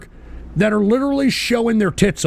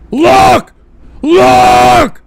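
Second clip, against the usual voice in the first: two much higher, much louder shouts; 8 semitones higher, 10 dB louder than usual. Acted. Streamer yelling at viewers to watch the chat, shouting. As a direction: yell it at them.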